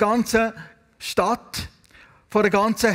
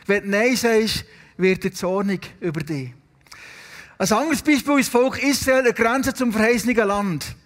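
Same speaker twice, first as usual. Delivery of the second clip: normal talking voice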